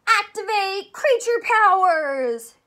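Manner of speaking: high, loud and slow battle cry; hammed-up cartoon catchphrase, loud and high; excited catchphrase. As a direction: call it out like a superhero transforming. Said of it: "Say it with me one more time".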